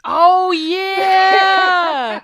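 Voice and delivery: sudden high, loud cry of recognition; excited shout. Real, not acted. Co-host suddenly remembering the hide-and-seek part, excited shout. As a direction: blurt it out as the memory hits.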